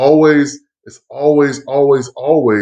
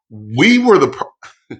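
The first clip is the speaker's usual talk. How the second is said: normal talking voice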